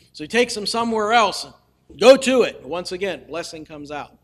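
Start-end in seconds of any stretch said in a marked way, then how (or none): 2.0-2.6 loud order. Retold or imitated. King orders again.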